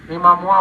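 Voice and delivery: "इमामुआ" with an added -ua ending; regional nickname form. Retold or imitated. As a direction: say "इमामुआ" like the folk over there. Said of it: "उधर के लोग".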